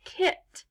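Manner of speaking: "Kit" with a short reduced ih vowel; quick ih sound. Shown as a demonstration. Teacher shows how the syllable sounds, short-vowel "Kit". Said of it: "just makes a quick I sound".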